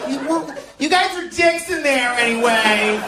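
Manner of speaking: very high put-on voice of a man at the border; mock high-pitched pleading turned cursing. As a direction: squeal it in a hammed-up character voice. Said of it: "this is a Mexican at the border".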